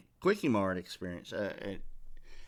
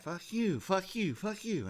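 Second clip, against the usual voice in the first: mock angry yelling; 10 semitones higher than usual. Retold street confrontation insults.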